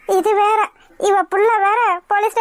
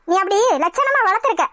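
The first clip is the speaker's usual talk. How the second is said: usual speaking voice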